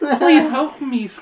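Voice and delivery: high, loud, slow mock plea; hammed-up Russian pleading; helpless Russian. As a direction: beg slowly in a helpless Russian voice. Acting a helpless young Russian.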